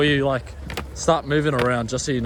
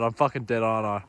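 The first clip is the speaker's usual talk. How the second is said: ordinary talk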